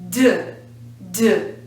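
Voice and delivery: voiced "duh" for "d"; isolated D sound. Shown as a demonstration. Teacher introducing the third possible sound, voiced "d". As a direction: say "d" as a short voiced sound, not the letter name. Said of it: "also has a vibration".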